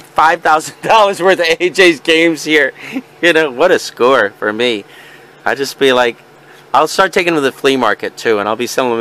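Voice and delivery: ordinary delivery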